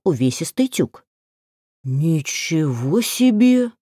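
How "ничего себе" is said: amazed exclamation. A narrator's reading. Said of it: "ухнул Велька".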